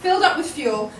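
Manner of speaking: ordinary talk, nothing marked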